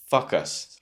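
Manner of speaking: fuck us, short open vowel instead of long O; mispronounced focus. Shown as a demonstration. Teacher showing students the rude-sounding slip, mispronounced. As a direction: say focus wrongly so it comes out fuck us. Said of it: "if you put it all together".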